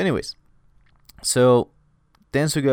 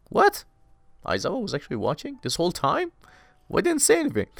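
a much higher, outraged what; shocked disbelief; 9 semitones higher than usual. Real, not acted. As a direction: burst out in disbelief that the teacher was watching. Narrator shocked Aizawa was watching.

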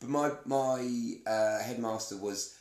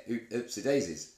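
Notting Hill quote. Retold or imitated. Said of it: "That's from Notting Hill".

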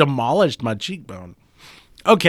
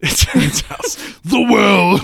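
mock exclamation; 8 semitones higher, 7 dB louder, slower than usual. Mocking friend's beetle pity.

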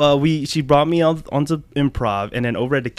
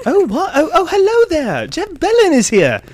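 high, excited put-on British host; mock British accent; 5 semitones higher than usual. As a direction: fake surprise as a posh host. British host greets guest.

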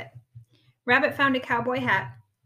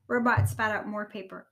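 usual speaking voice

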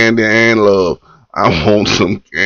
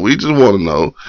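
usual speaking voice

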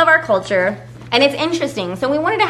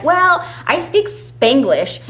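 raised pitch, slow and drawn out; demonstrating Spanglish speaker; 6 semitones higher, much slower than usual. Acted. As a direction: announce it slowly and playfully. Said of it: "where it's like".

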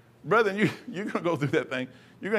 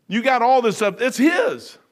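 emphatic exclaim; 8 semitones higher, 6 dB louder than usual. Retold or imitated. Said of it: "And I'm sitting there going".

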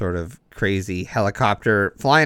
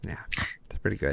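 whisper; 3 semitones lower, 14 dB quieter, much faster than usual. Pretend.

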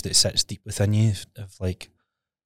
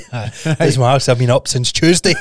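laughing; 6 semitones higher, 4 dB louder than usual. Real, not acted. Joking along, laughing.

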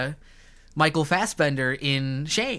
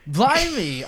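higher, swooping mock-British exclamation; mock British accent; 5 semitones higher than usual. Host mocking British actors to co-hosts, exaggerated British accent. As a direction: exclaim it like a cartoon Brit. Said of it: "These guys are just doing accents".

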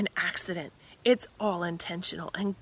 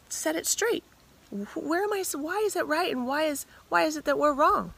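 higher, stammering, pressing voice; the woman pleading with Jesus to set it straight, higher urgent voice; urgent plea; 8 semitones higher than usual. Acted. Woman demands an answer from Jesus.

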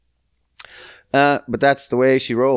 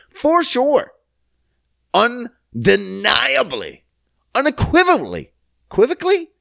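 mock grand boast; 10 semitones higher than usual. Mock boasting about podcast.

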